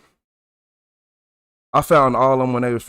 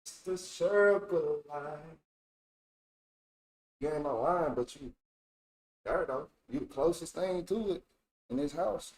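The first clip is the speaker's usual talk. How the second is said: murmuring to the cat; 3 semitones higher, 15 dB quieter than usual. Real, not acted. Softly telling the cat it's closest thing.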